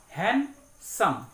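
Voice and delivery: हैंडसम said slowly as हैंड-सम, two clear beats; हैंडसम spoken hand-sum with a pause between parts; split into syllables. Shown as a demonstration. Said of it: "दो बार में हम लोग उसको उच्चारण करते हैं".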